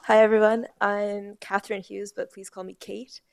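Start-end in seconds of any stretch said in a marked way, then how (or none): none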